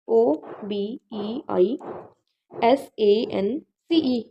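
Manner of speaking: letters spelled out very slowly and softly; drawn out letter by letter so students can copy; slow spelled dictation. Shown as a demonstration. Teacher spells obeisance for students, slow and soft. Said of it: "लिखा हुआ है".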